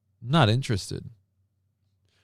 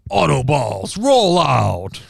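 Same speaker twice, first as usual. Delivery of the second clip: loud, drawn-out robot hero battle cry; rally the robots with a mock-heroic command; hammed-up cartoon commander, projecting loudly; Autobot commander call; 5 dB louder, much slower than usual. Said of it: "I'm, like, picturing saying".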